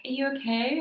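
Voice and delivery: high concerned question, lilting up at the end; imitated sympathetic tone. Boss checking in on an upset employee, imitated tender concern. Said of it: "In that tone".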